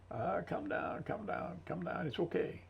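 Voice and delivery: high, soft calming voice; hushed and gentle, talking a man down; soothing. Retold or imitated. Talking down an angry guy in the barracks, soft and high, soothing.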